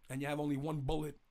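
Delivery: ordinary talk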